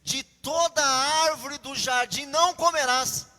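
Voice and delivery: slow, emphatic questioning of a rule; lightly voiced serpent, spelling out each phrase; insinuating serpent. Serpent twisting God's order to Eve, slow insinuation. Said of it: "O que que a serpente disse, insinuou?".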